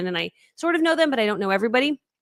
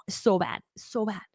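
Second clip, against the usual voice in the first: ordinary delivery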